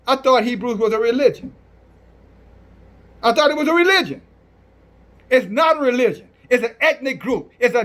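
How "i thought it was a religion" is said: higher, quick mimicking of a doubter; mock objection. Preacher imitating those who think Hebrew is religion, mocking. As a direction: parrot the objection fast and high.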